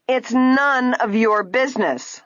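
high, slow, indignant rebuff; angry retort. A narrator's reading. Ada in a rage at Nancy holding the dress, angry retort. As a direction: stretch the words out in haughty outrage.

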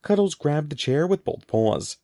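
usual speaking voice

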